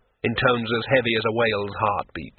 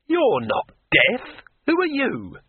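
shocked, much higher, slow at first; startled cry; 13 semitones higher, slower than usual. A narrator's reading. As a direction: cry out in alarm at the stranger. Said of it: "cried Rincewind".

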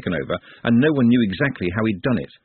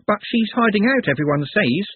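forlorn; 10 semitones higher than usual. A narrator's reading. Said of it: "the girl's plaintive voice".

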